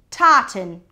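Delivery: loud eager cry; boy crying out his idea. Acted. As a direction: shout the word out keenly. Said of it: "cried Bertie".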